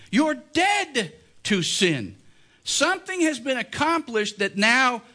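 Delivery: fervent pulpit appeal; preaching at full force. Acted. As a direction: press the point on the congregation. Preacher voices Paul's argument.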